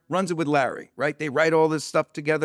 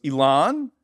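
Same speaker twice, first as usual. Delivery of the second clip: high, louder astonished question; acted surprise; 7 semitones higher, 6 dB louder, slower than usual. Director spots an old acquaintance in the office, surprised. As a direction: blurt it out in pitched-up surprise.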